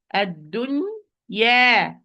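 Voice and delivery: ad-dunya drawn out very slowly; slow motion. Shown as a demonstration. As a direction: stretch every sound of ad-dunya. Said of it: "I'm saying in slow motion".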